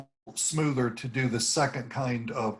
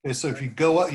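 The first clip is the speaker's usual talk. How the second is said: ordinary talk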